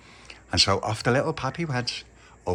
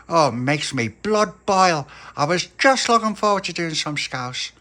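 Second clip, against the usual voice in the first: indignant Scouse mum ranting, higher; fed-up mum; 5 semitones higher, 5 dB louder than usual. Acted. Mum complaining to little pappy about potatoes, fuming. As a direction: mum boiling over about bad potatoes.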